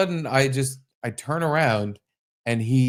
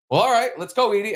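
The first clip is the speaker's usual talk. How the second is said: high-pitched, flustered hurry to leave; nervous high exit line; 9 semitones higher, faster than usual. Retold or imitated. Telling the dog to go, high-pitched nervous voice. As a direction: pitch up and rush the dog along. Retelling awkward escape from stranger.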